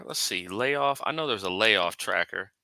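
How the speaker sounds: ordinary talk, nothing marked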